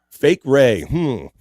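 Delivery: ordinary delivery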